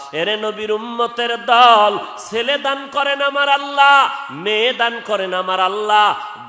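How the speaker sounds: high, loud rhythmic proclamation; full-force preaching. Real, not acted. Preacher proclaiming that Allah gives children, high forceful chant. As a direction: hammer out each parallel phrase like a rallying chant.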